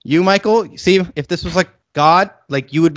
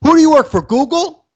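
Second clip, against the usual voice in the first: much higher and louder, jeering voice; mocking taunt; 13 semitones higher, 5 dB louder than usual. Mocking the fighter's shirt taunt, shrill jeer. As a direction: squeal it like a taunting show-off.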